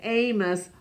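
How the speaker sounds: usual speaking voice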